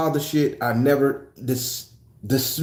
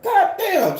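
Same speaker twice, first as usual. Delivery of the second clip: shocked curse. Retold or imitated. Young self cursing at hit.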